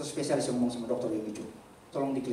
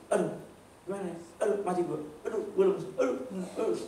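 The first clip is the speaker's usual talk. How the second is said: mock despairing groan; 4 semitones higher than usual. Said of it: "langsung ngomong gini".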